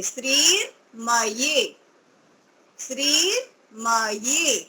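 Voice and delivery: श्रीमयी said very slowly in two parts, श्रीर् then मयी; teacher splitting श्रीमयी in two; split into two parts. Shown as a demonstration. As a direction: break श्रीमयी in two for the learner. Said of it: "इस तरह से बोल के देखेंगे".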